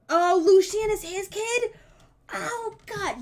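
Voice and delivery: high, loud, slowed gasp of disbelief; real shock at the reveal; shocked realization. Real, not acted. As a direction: blurt it out, stunned, dragging the words. Shocked realization mid-game.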